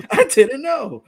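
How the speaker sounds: laughing through the words, drawn out; laughing admission. Real, not acted. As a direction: admit it through real laughter. Amused admission while laughing.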